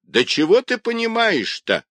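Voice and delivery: very high, scornful cry; old woman shouting. Acted. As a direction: shoot the question out high-pitched. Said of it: "продолжала кричать старуха".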